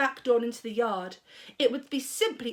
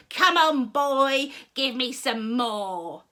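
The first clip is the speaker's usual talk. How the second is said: put-on louder old grandma yell, slowing at end; 5 dB louder, slower than usual. A narrator's reading. Grandma yelling at George for more. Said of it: "she yelled".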